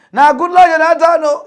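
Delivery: high, loud rally cheer; hammed-up campaign shout; mock crowd shout. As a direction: yell the name like a fan. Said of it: "dey go shout".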